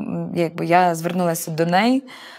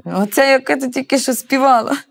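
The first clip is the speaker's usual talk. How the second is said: indignant retort; 7 semitones higher, 4 dB louder than usual. Retold or imitated. Peter angrily claims the song.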